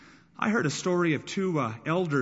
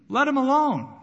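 crowd shouting; 10 semitones higher, 7 dB louder than usual. Retold or imitated. Crowd voiced hushing the man.

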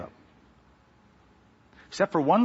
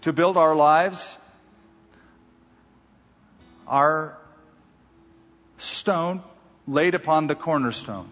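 pastoral prayer. Acted. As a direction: pray over the flock with reverent weight. Pastor leads closing prayer.

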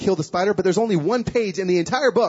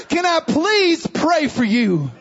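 pleading shout; 6 semitones higher, much slower than usual. Retold or imitated. Stranger begs Marcus in the parking lot, slow pleading shout. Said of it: "I'm just shaking".

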